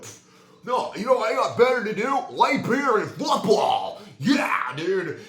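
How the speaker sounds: high, loud, slow dumb-guy voice; mocking lazy guy. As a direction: ham up a lazy beer-and-football bro. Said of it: "people are like".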